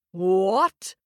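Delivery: loud giant bellow, rising. Acted. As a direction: shout it in disbelief. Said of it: "bellowed the giant".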